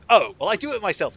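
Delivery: high, loud mock confidence; cocky adventurer. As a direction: brush it off with hammed-up bravado. Acts out player deciding after refusal.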